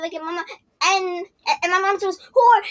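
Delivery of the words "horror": sudden scream, higher and much louder. Retold or imitated. Mom shrieking at the faceless girl, loud scream. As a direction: shriek it out in shock. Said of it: "and screams".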